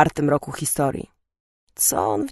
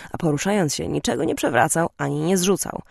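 ordinary delivery

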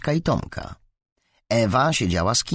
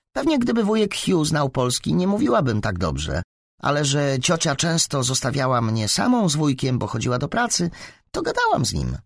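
lightly voiced girl, pitched up; chatty schoolgirl; 7 semitones higher than usual. Kamila tells classmates about her aunt and uncle, lightly voiced chatty girl.

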